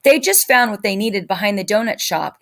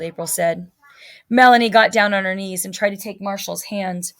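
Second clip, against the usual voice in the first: usual speaking voice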